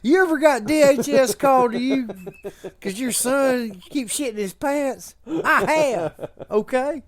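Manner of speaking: imitating kid Scotty. Friend voices little Scotty.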